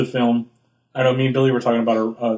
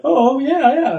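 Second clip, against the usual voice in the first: high, louder "oh yeah"; hammed-up pretend understanding; mock knowing agreement; 14 semitones higher, 5 dB louder, slower than usual.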